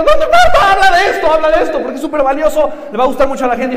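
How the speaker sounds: very high, loud insistent voice; imitating Chris. Chris urging the speaker to talk about it, high mock insistence. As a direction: push him hard to do it. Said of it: "me dijo".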